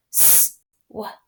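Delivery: whispered "Swipe," while swiping; soft swipe demo. Shown as a demonstration. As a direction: breathe "Swipe," softly as you swipe. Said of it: "tap the sounds and swipe".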